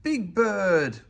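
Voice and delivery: loud, high cry of joy; glad cry. Acted. Granny greeting Big Bird with a hug, loud and happy. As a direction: call his name in delight. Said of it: "Granny cried".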